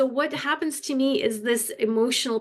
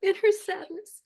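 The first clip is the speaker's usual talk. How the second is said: high, tear-choked voice with halting breaks; real weeping, pushing the words out between pauses; crying; 9 semitones higher than usual. Real, not acted. Waitress telling the group about a lonely elderly lady, crying.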